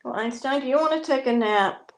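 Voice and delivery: high, sing-song talk to a pet bird. Real, not acted. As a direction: coo at the parrot sweetly. Cooing to her parrot.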